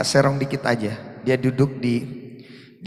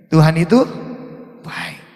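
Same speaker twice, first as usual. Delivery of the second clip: loud proclamation; 5 semitones higher, 7 dB louder than usual. Acted. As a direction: proclaim "Tuhan itu" loud then settle "baik". Repeated aloud after whisper.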